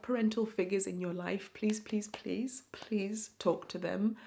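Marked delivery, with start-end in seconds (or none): none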